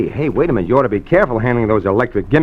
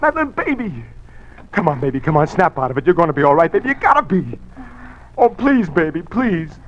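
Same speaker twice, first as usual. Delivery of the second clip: desperate pleading; 6 semitones higher than usual. Acted. Reviving his dying wife.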